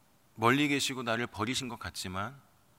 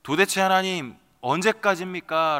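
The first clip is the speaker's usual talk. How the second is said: higher and louder, crying out to God; raised, pleading cry of despair; anguished outcry; 5 semitones higher, 6 dB louder than usual. Acted. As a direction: let it burst out as a desperate plea. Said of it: "라고 하는 절규가".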